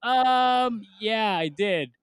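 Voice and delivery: high, drawn-out "um", then owning up; sheepish admission. Retold or imitated. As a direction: act out an embarrassed, reluctant confirmation. Sheepish reply to mocking classmates.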